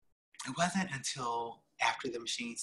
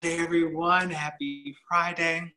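drawn-out greeting; 5 semitones higher, 6 dB louder, slower than usual. Real, not acted. Greeting audience with drawn-out Friday.

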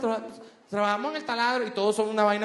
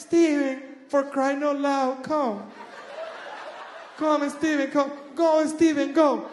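light gringa mom, pleading; American mom accent; 5 semitones higher, much slower than usual. Retold or imitated. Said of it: "Aquí es". (acento gringo suave de madre, exagerado)

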